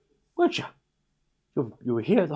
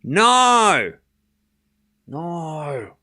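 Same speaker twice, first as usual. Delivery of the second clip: Australian no; 5 semitones higher, 5 dB louder, much slower than usual. Shown as a demonstration. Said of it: "You tell the level of bummed out by Australian saying no".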